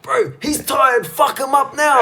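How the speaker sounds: high, loud, drawn-out cornerman shout; imitated yell. Imitating an old-school cornerman yelling at his fighter, loud high shout. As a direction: holler it like an overexcited cornerman. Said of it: "he'd just always yell".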